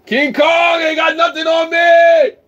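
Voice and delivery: very high, loud and slow shouted boast; roaring a boast for the stream; mock roaring shout. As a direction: bellow it slowly like a giant beast. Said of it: "there was a lot of yelling".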